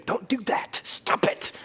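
hushed, unvoiced aside; a teasing stage whisper to the church; mock whisper. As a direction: whisper it like a playful secret warning. Joking aside to congregation.

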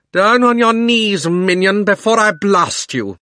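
loud, high, slow threat; commanding roar. A narrator's reading. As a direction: roar the order down. Witch commanding.

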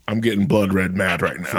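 ordinary talk, nothing marked

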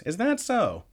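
"Is that so?" tossed off, pitched high, mock nonchalant; unbothered reaction. Retelling the dad's reaction to the news, mock casual. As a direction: imitate the dub's oddly casual shrug. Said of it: "He doesn't freak out".

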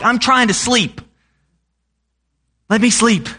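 high-pitched mock whine at being woken; a hammed-up grumpy parent, woken from a nap; whiny snap.

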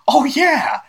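high, loud whoop in character; excited cheer. Acted. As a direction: burst out with eager delight. Game master voices Clixon, excited.

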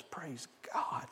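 whispered thanks, barely voiced; awed whisper. Healed mute man whispers his first words in awe. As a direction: breathe it out in hushed awe.

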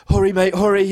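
high, acted urging; retold urgent plea in a high voice; urgent pleading.

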